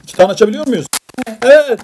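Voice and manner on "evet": excited yes. Real, not acted. Answers child's question brightly.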